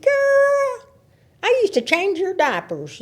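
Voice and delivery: put-on high-pitched drawn-out "Girl", then easing down; retold high-pitched exclamation; mock exclamation. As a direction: imitate an old family friend exclaiming. Old family friend greets her, retold.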